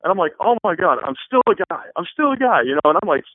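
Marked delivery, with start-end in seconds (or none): 0.4-1.9 higher, louder flustered exclaim; mock panic, embarrassed; pitched up exasperated outburst; retold freaking out. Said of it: "freaking out". 2.0-2.6 even higher, louder repeat of I'm still a guy; climbing exasperated repeat; bigger retold freak out. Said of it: "freaking out".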